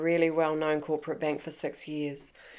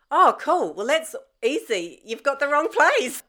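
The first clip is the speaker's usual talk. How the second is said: breezy dismissive; 10 semitones higher, 5 dB louder than usual. Retold or imitated. Breezy reply to arresting cops.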